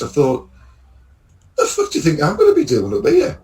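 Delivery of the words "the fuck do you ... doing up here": high, incredulous mock outrage; exasperated outburst. Driver to the lad on the lowered lift, exasperated. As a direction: throw it up in disbelief, half-laughing.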